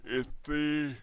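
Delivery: yawning. Real, not acted. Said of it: "I'm sorry, I yawned again".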